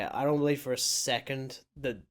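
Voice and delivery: ordinary talk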